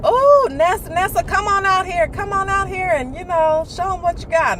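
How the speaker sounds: put-on high, loud coaxing call to the child; parents calling her out. Parents at a get-together calling the girl out, high loud coaxing. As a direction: call her out to show off at the party. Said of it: "they would be like".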